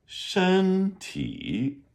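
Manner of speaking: "Shen ti" with "Shen" high and level; tone demo. Shown as a demonstration. Teacher repeats "Shen ti" for students. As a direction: hold "Shen" high and even. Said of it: "the word shen".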